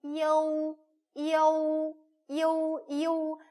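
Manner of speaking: 이오우 voiced high and loud, gliding; Chinese model syllable. Shown as a demonstration. Teacher answers her own question with 이오우, high. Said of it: "그러면 어떻게 읽어요?".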